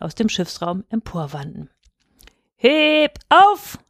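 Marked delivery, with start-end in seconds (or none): 2.6-3.8 high, louder work call; sailors' shout. A narrator's reading. Sailors to each other, hauling bales on ropes, shouting. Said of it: "schrien sie bei jedem Ruck am Tau".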